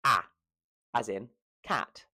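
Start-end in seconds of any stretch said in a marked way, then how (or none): 0.0-0.2 "a" as the short vowel in cat; short A sound. Shown as a demonstration. Teacher showing the short A, "a" as in cat. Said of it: "You have the short A".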